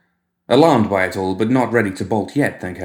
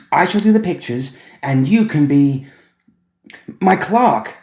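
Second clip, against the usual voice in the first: put-on high, louder, eager little boy; excited child; 8 semitones higher, 5 dB louder than usual. Boy telling Edmund about his gallery, high and eager. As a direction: gush like a thrilled small boy.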